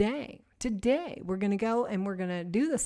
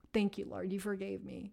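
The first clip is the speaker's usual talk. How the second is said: soft grateful prayer, much quieter; hushed thanks; 3 semitones lower, 10 dB quieter than usual. Shown as a demonstration. She quietly thanks the Lord after confessing, hushed.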